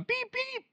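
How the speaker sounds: high, level mock bleep; imitated censor beep. Preacher censoring Peter's curse words, high mock beeps. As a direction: bleep it out with your own voice.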